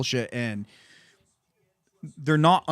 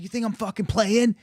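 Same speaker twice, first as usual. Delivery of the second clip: higher-pitched imitation of an angry attacker; mock aggressor; 9 semitones higher, 4 dB louder than usual. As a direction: mimic his indignant challenge, pitched up.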